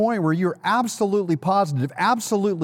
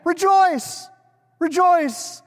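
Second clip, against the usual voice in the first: rejoicing exclamation; 13 semitones higher, much slower than usual. Shown as a demonstration. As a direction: shout "Rejoice" out to the congregation. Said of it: "I'll say it again".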